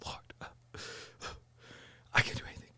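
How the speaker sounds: whispered, broken, near tears; despairing whisper. Retold or imitated. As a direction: breathe it out like you're about to cry. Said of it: "I kinda cried".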